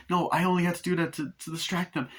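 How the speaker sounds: high-pitched mock pleading; acted frantic self-justification; pleading excuse. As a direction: plead desperately to be believed. Acted husband pleading with wife.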